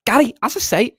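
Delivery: high, badly faked Scouse; Jamie Carragher. Pundit addressing Gary, badly faked Scouse accent. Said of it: "I can't do a Jamie Carragher accent".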